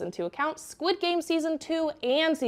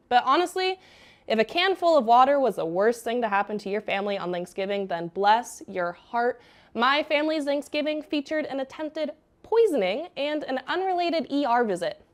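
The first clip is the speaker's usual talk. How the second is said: ordinary talk, nothing marked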